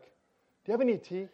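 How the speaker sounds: soft, gentle mock offer of tea; quiet, polite imitation; calm midwife. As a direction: ask softly, as an unruffled midwife. Calm midwife asks, retold.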